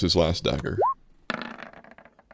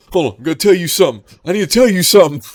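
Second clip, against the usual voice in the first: high, loud, rushed; urgent interruption; 11 semitones higher, 9 dB louder, faster than usual. Retold or imitated. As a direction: cut in urgently. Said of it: "That girl's like".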